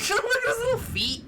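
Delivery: high, squealing coo with laughter; overwhelmed by cuteness. Real, not acted. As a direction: gush over the tiny feet. Cooing at cute creature.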